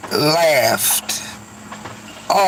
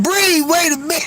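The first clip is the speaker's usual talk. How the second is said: laughing quote; 10 semitones higher, faster than usual. Retold or imitated. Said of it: "The chat said".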